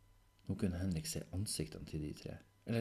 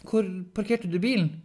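high, loud but more controlled question; hesitant repeat; 13 semitones higher, 11 dB louder than usual. Acted. Henrik repeating the question when asked, controlled but insistent.

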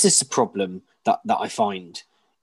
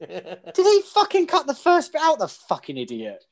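incredulous inner voice; 11 semitones higher than usual. Retold or imitated. Mocking the friend's editing in high incredulous disbelief. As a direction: scoff in astonishment at the edit. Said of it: "I was listening to it like".

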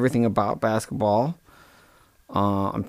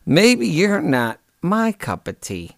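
slow pointed; 6 semitones higher, slower than usual. Real, not acted.

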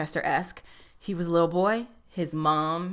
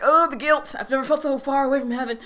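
much higher, louder hammed-up lament; melodramatic guilt; 6 semitones higher, 5 dB louder, faster than usual. Mocking Joe's guilt after the van scene, hammed-up dramatic lament. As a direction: overplay the tortured lover for laughs. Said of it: "Joe is like".

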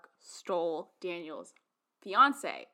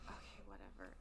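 dismissive murmur; 22 dB quieter than usual. Retold or imitated. Daniel shrugging it off.

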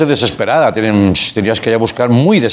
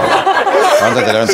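mock ovation; 10 semitones higher, slower than usual. Mock ovation after the poem.

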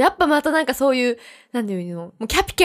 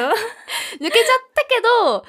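laughing repeat; 8 semitones higher than usual. Real, not acted.

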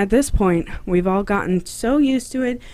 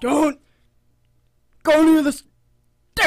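frat bro; 9 semitones higher, 4 dB louder than usual. Retold or imitated. Mimics the frat warning.

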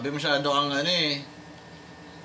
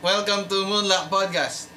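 raised, drawn-out host greeting; higher-pitched intro spiel, slowing on the welcome; podcast host spiel; 5 semitones higher, slower than usual. Shown as a demonstration. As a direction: try out your show-opening welcome, pitched up. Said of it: "Paano spiel mo?".

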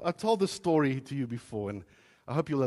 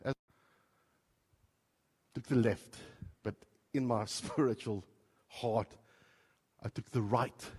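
ordinary talk

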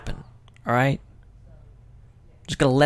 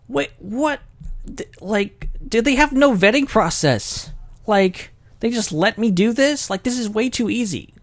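high-pitched mock disbelief; incredulous reaction; 11 semitones higher than usual. Podcaster retelling his reaction to instant approval, incredulous. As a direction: act out being baffled, voice pitched way up.

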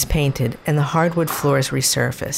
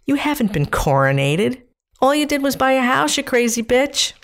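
higher, exasperated self-scolding; scolding inner voice; 9 semitones higher than usual. Retold or imitated. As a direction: tell yourself off bluntly. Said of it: "said my inner voice".

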